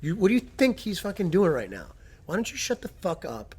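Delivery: high, loud exasperated telling-off; heated, high-pitched re-enacted rant; retold angry outburst. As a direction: explode at the know-it-all as you retell it. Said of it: "I just exploded".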